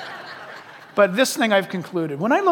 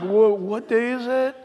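groggy waking up; slower than usual. Retold or imitated. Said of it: "you're just kinda like".

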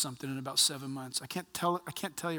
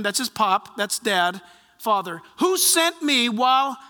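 loud, raised preaching voice; 8 semitones higher, 8 dB louder than usual. Acted. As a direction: proclaim it to the congregation. Preaching the verse with force.

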